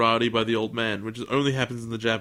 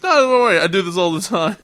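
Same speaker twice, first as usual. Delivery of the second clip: bright carefree reassurance, pitched up and louder; tossing it off like it's nothing; high cheerful brush-off; breezy boy; 7 semitones higher, 8 dB louder, faster than usual. Retold or imitated. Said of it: "the guy goes".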